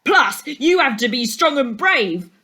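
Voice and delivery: high, loud heckling; boastful boy calling out. A narrator's reading. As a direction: shout it across the classroom. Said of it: "Kevin called out".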